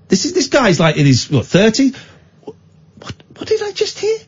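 disbelief. Retold or imitated. Inner disbelief at the demand.

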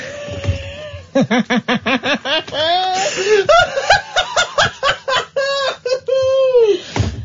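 high wheezing laugh fit; shrieking laughter. Real, not acted. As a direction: howl with laughter. Host loses it at clip.

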